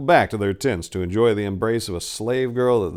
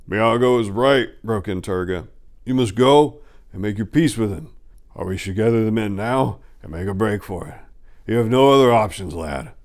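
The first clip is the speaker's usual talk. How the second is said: blunt companion, louder; lightly voiced Turga; 5 dB louder than usual. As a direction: push the lad to decide.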